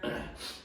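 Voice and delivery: quick soft throat clear. Real, not acted. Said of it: "Bear with me, I have this cold".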